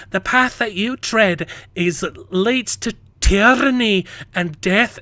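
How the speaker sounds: loud, high, slow theatrical declamation; dramatic character quote. Acted. Quoting the line to his daughter, theatrical declamation. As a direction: proclaim a hammed-up fantasy warning.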